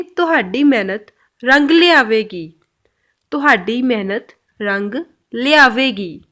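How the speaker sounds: slow, firm Punjabi encouragement; slow encouraging Punjabi. Shown as a demonstration. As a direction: reassure them slowly. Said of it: "Punjabi में कैसे बोलेंगे?". (धीमे, हौसला देते हुए पंजाबी में)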